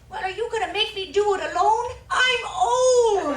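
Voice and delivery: high, wailing mock plea; hammed-up cry of complaint; guilt-tripping grandma. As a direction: wail it loudly like a put-upon granny.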